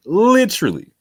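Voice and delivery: high, loud, punched "Literally"; "Literally" shot up as a one-word exclamation; emphatic echo. Real, not acted. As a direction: throw the word back hard.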